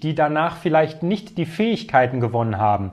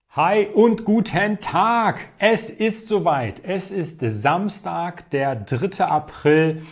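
hyped giveaway announcement; 4 semitones higher, slower than usual. Acted. Video opens the giveaway draw.

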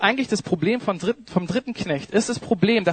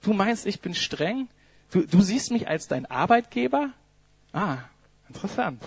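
soft, incredulous questioning, barely above a hush; the master quietly turning the servant's words back; ironic master; 4 dB quieter than usual. Acted. Quiet, dry disbelief. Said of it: "Jesus ... sagt".

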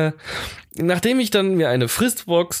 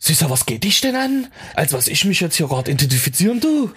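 mock East German dialect, sassy; East German accent; 3 semitones higher than usual. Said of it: "Warum mit dem Össi-Dialekt?". (hoher, tuntiger Ossi-Akzent)

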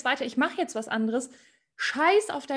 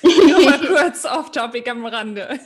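much louder, slow, laughing through the words; laughing aside; 11 dB louder, slower than usual. Real, not acted. Host interrupts the guest with a joke, loud and laughing. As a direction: blurt the aside while cracking up.

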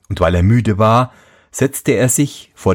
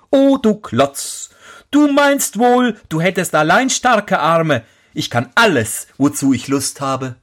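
mock the giant boldly; defiant prince; 7 semitones higher than usual. Prince taunts the giant who thinks only he is strong.

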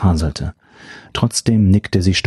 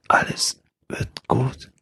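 breathy, softer whispered echo, slowly; weak whisper; 5 semitones higher, 7 dB quieter, slower than usual. Acted. Injured woman weakly echoing the professor's promise, whispered. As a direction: whisper it back slowly, barely there. Weak patient repeats words.

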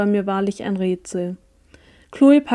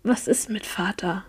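much softer whispered question; hissed whisper; 7 dB quieter than usual. A narrator's reading. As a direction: hiss it under your breath. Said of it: "senkte meine Stimme, als ich zischte".

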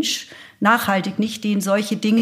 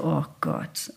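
resigned; 6 semitones lower, 9 dB quieter than usual. Retold or imitated. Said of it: "Und ich so".

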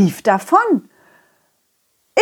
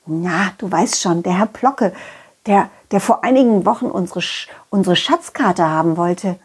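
plain reading voice

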